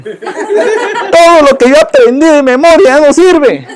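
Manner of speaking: real amused grumble; laughing. Real, not acted. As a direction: chuckle out the lament about the memorized psalm. Joking about changed Psalm.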